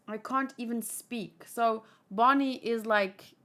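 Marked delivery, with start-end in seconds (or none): none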